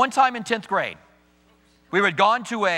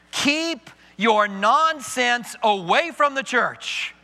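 normal talking voice

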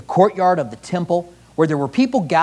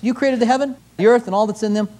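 normal talking voice

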